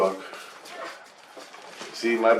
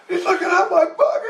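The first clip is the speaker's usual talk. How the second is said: a much higher, laughing imitation of Jesus; high mock Jesus voice; 3 dB louder than usual. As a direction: squeak it out through a laugh, hamming up Jesus. Jesus voiced in dream story.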